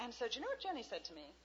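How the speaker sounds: ordinary delivery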